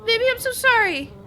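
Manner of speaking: loud, high, desperate sorry; frantic apology. Real, not acted. Apologizing frantically to game baby.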